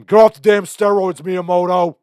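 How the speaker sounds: high, very loud screamed impression; Keegan-Michael Key. Comedian as a coach screaming at children, mock yelling. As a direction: yell it like a crazed sketch-comedy coach. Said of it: "wants to hear Keegan-Michael Key going".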